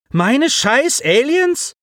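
high, slow outraged rise on Aliens; snapping back. A narrator's reading. Simon snaps back at Alex, high outraged question. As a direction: throw the words back in disbelief. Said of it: "fuhr Simon ihn an".

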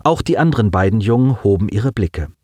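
plain reading voice

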